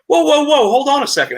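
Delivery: high, loud startled whoa; AJ Styles interrupting. Retold or imitated. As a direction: cut in fast and high, stopping the show. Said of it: "And he's like".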